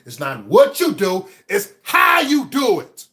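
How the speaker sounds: loud, high punch on the key words; preacher driving the point home; emphatic shout. Real, not acted. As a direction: build to a drawn-out shout. Driving home his motivational point.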